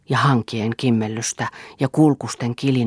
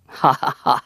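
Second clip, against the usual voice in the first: bitter laugh. Acted. Rolf's bitter mock laugh.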